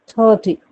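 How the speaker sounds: "Thirty" with a strong "थर", short falling "टी"; stress on THIR. Shown as a demonstration. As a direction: punch the first syllable of "thirty". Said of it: "अब stress दिया जाएगा इस पे".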